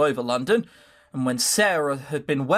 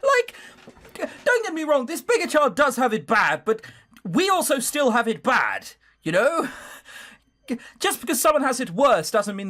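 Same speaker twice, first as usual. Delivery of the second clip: animated protest; 8 semitones higher than usual. Real, not acted. Player arguing out of character.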